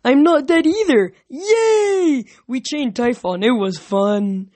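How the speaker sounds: high, loud cheer easing down; gleeful, drawn-out cheering; happy excitement. Acted. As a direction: whoop with childlike glee, then settle. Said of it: "laughed happily".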